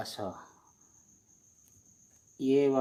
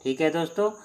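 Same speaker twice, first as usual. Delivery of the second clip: ordinary talk, nothing marked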